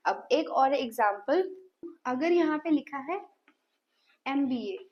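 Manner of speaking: ordinary delivery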